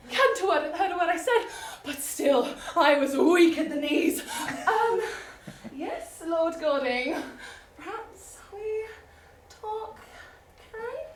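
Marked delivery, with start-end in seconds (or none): 4.7-11.1 flustered lady. Acted. Heroine flustered reply.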